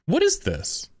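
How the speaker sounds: high, bewildered question; baffled. Real, not acted. Streamer baffled by the leader's excuse. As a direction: toss it up in confusion.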